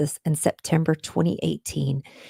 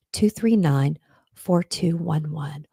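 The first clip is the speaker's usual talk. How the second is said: usual speaking voice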